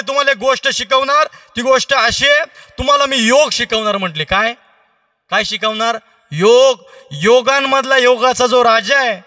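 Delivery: high, loud proclaiming voice; grand announcement. Acted. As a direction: proclaim the gift of yoga. Sadhu announces yoga teaching.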